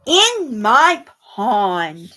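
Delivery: slow, gentle reading; poem for children. A narrator's reading. Reading a pond poem to young children, slow and gentle. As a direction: savour each image slowly.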